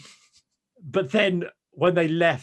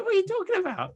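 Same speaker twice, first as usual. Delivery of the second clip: very high squeak of disbelief; incredulous outburst. Retold or imitated. Fan shrieking at the moaning family in disbelief. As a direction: shriek your disbelief at them.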